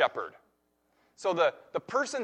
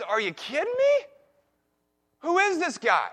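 incredulous; 10 semitones higher than usual. Retold or imitated. David hears Goliath taunting God.

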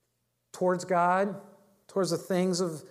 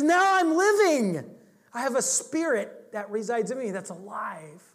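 triumphant; 6 semitones higher, 3 dB louder than usual. Retold or imitated. Ironside proclaiming his present to the woman, triumphantly. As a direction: ring out the joy of new life.

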